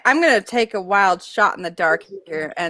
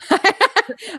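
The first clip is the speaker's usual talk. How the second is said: laughing; 10 semitones higher than usual. Real, not acted. Laughing at repeated nagging.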